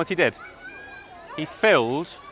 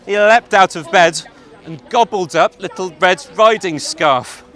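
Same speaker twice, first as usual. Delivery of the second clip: loud, fast storytelling; excited narration; 3 semitones higher, 7 dB louder than usual. A narrator's reading. Narrator tells the children the pounce, loud and fast. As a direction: rush it like the big moment.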